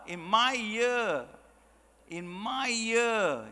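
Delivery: very slow, lingering self-importance; mock-pompous boast. Speaker mimicking past president's boast, slow and pompous. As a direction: stretch "my" and "year" out mockingly. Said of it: "that's how ridiculous it sounds".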